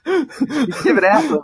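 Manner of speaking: very high, slow, put-on voice; ribbing a sledger who can't take it; teasing mock voice. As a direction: needle him in a squeaky mocking tone.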